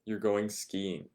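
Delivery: usual speaking voice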